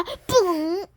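spoken fart noise 뿡 popped out; mock fart. Fart character farting at the others. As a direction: blurt a fake fart.

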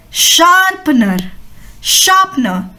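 शार्पनर drawn out slowly, r softened; slow model word. Shown as a demonstration. Teacher slowly models शार्पनर for children. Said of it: "तो आप बोलते हैं".

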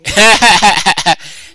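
high, loud burst of laughter. Real, not acted. Reacting to the clip's joke.

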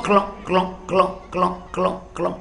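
short hollow repeated clucks, dying away; imitated boiling pot. Stones boiling in mother's pot, imitated bubbling that fades away. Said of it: "Dia gelegak".

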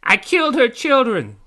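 high, loud fairy scream. A narrator's reading. Tink finishing her lines to pirate, high scream. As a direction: finish with a high triumphant yell. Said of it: "and finished".

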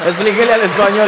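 egging a friend on; shouting at a friend to explain; excited call-out. Real, not acted. Joking about explaining in Spanish.

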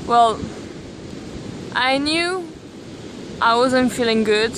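ordinary talk

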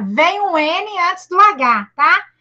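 ordinary talk

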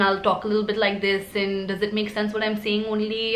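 light Indian English accent, level and conversational. Shown as a demonstration. Demonstrating code-switching to put an interviewee at ease, Indian accent. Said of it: "my accent will switch a little".

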